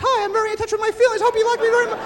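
high, quick puppet voice; squeaky little puppet chattering its feelings; sex puppet. Acted. Shy lover speaking through a puppet, high puppet voice. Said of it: "I need sex puppets".

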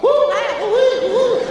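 high, loud mock siren; siren noise. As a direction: whoop it like a cartoon siren. Imitating Marx Brothers siren.

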